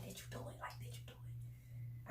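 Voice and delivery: hushed, breathy whispered question. Retold or imitated. The friend asking her secretly about the dare, whispering. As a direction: whisper it so nobody else hears.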